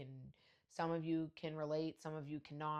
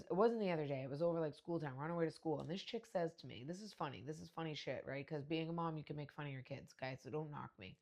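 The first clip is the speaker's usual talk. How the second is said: usual speaking voice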